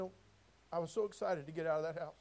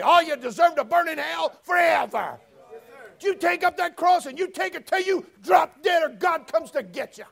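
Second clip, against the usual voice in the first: higher, louder preacher's shout; preaching at full force; 8 semitones higher, 15 dB louder than usual. Acted. Preacher to congregation about Jesus spat on, shouting. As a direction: hammer each phrase from the pulpit.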